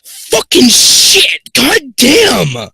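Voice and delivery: loud, high shout of shock; real startled. Real, not acted. Reader jolted by a scare mid-story, startled shouting. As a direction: curse at the top of your voice after a fright. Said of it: "Scared the hell out of me".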